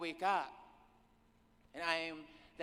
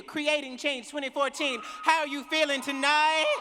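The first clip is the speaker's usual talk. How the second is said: shouted crowd call, pitched up and rising at the end; louder call again; 7 semitones higher, 7 dB louder than usual. Real, not acted. Speaker repeats her crowd call, pushing much louder. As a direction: shout it louder to rouse the room. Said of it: "I don't know if I believe you".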